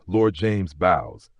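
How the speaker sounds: plain reading voice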